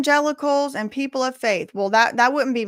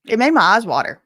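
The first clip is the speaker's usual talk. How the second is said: emphatic; 8 dB louder than usual. Real, not acted. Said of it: "repeated quieter".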